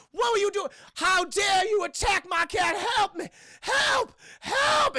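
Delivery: high, loud mock Southern-lady shriek; hammed-up outraged screaming. Voices woman outraged over attacked cat.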